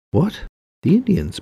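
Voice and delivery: high-pitched, startled echo question; incredulous. A narrator's reading. Bolio echoing Ray's suggestion of the Indians, incredulous. Bolio taken aback, echoes Ray.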